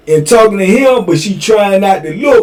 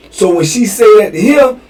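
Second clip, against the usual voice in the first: ordinary talk, nothing marked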